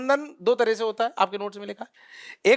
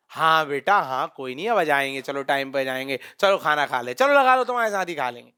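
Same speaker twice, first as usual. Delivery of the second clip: soothing father; 9 semitones lower than usual. Retold or imitated. Father calming upset daughter.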